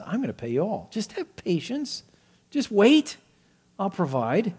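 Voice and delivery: high, pleading stall; servant stalling. Acted. Voicing the servant's glib promise.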